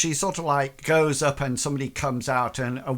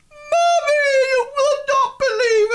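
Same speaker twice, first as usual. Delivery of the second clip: very high, loud mock sobbing; overboard wailing; 8 dB louder than usual. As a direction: ham up a daughter wailing to mommy. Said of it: "she sort of goes".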